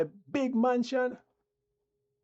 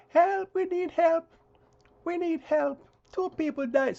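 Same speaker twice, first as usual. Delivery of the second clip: mock plea for help; 8 semitones higher than usual. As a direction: whine it high to mock the pleas. Mocking cries from Colombia.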